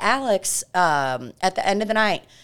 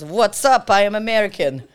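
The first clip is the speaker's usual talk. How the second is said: put-on loud, higher Russian-accented phone greeting; imitated Russian accent, raised voice; 4 semitones higher, 5 dB louder than usual. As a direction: answer brightly in a mock Russian accent. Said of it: "in a Russian accent".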